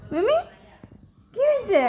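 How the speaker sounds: high cooing pet voice; pet talk. Real, not acted. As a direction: ask the frightened cat what's wrong. Coaxing a scared pet.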